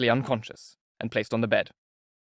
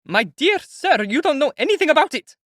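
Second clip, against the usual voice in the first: high, loud distraught shout; 7 dB louder than usual. A narrator's reading. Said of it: "Katerina Ivanovna shouted".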